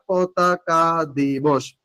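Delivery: "পতাকা দিবস" stretched out very slowly; slow dictation. Shown as a demonstration. Teacher asking what the day is called, slow emphatic dictation. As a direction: draw out each syllable to drill it in. Just কি এটা?.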